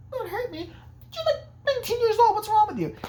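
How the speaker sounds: very high, crying mock plea; whiny victim. Mocking Alice as she begs the old woman, high and whiny. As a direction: whimper it like a helpless teen.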